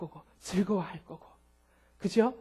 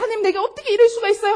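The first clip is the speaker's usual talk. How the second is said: high, fast, laughing whine at God; mock-tantrum complaint, rolling on the floor; whining complaint; 9 semitones higher, 5 dB louder, faster than usual. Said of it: "하면서 막 진짜 막 떼굴떼굴".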